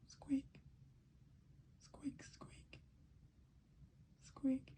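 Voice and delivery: tiny, soft high squeak; whispery high mouse noise. Acted. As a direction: squeak very quietly like a little mouse.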